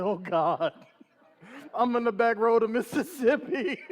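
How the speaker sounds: woeful oh-no, half laughing; mock panic. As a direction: play up the comic dread. Panicked reaction to being pulled over.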